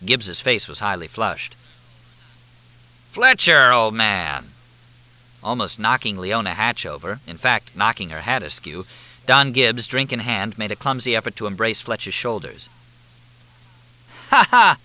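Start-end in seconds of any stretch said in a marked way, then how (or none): 3.1-4.5 hearty hail. A narrator's reading. Gibbs hailing Fletch at the party, loud and hearty. 14.3-14.8 loud high fake laugh; forced laugh. A narrator's reading. Gibbs greeting Fletch with a loud forced laugh.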